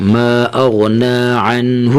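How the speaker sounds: slow, drawn-out recitation; Quran recitation. Shown as a demonstration. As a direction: chant the phrase slowly. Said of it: "recites the verse part".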